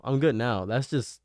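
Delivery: ordinary delivery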